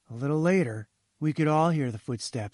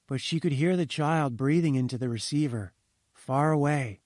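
usual speaking voice

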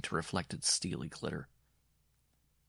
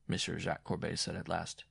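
ordinary delivery

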